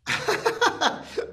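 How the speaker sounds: big, high laugh bursting out; real burst of laughter. Real, not acted. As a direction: laugh out loud, caught off guard. Laughs at the personal question.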